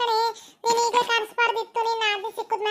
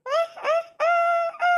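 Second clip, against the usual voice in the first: put-on slow, high babbling like the baby; imitating baby babble; 7 semitones higher, much slower than usual. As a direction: mimic the infant's aa aa sounds slowly. (ಮಗುವಿನ ತೊದಲು ಅನುಕರಣೆ)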